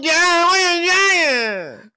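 put-on high, loud and slow child voice; a slowed, whiny little-kid imitation; drawn-out toddler voice. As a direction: stretch it out like a sobbing toddler. Crying kid mimicked again.

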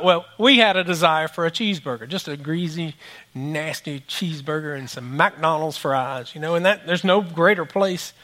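usual speaking voice

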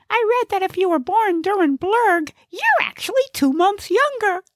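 very high comic character voice; high-pitched little know-it-all; chatty Gnesi. Acted. Gnesi makes conversation while driving, high chatty voice.

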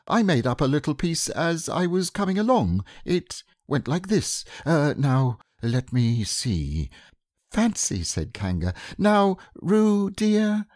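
lightly voiced characters; high, playful character voices; voiced story characters; storybook voices. A narrator's reading. Pooh and Kanga voiced.

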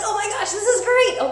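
high, loud thrilled outburst; thrilled kid exclaiming; excited kids. Retold or imitated. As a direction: voice the kids' delight. Kids excited about experiments.

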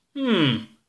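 the bearded creature considers the little alien; pondering hum. Acted. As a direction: hum while stroking the beard.

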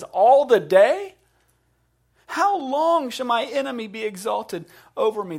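loud, high cry to God; David. Retold or imitated. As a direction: cry it out as a pleading lament. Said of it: "That's a cry that we're familiar with".

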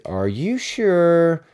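very slow, high mock-doubtful question; playful drive-thru clerk. Drive-thru guy checks the order, teasing and drawn out. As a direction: draw every word out, teasing the customer. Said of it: "just horsing around so much".

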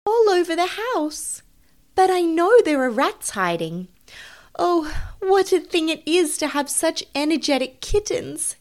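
high-pitched, fussing mother voice; fretful mother cat. Acted. Tabitha worn out by her kittens, high and fretful. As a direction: lament in a high, flustered voice.

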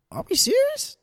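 are you kidding me; telling listeners about a traffic stop, exasperated; incredulous. Real, not acted. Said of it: "outraged at the cop".

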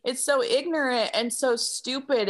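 ordinary delivery

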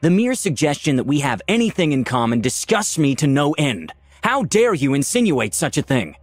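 outraged. A narrator's reading. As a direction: protest as if deeply insulted. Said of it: "shouted Valeria, her voice resonating".

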